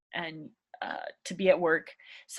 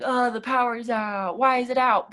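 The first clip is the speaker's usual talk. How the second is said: annoyed complaint; 4 semitones higher, 4 dB louder than usual. Shown as a demonstration. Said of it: "you don't wanna be like".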